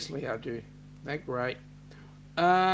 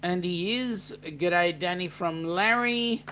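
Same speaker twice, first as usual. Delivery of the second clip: ordinary talk, nothing marked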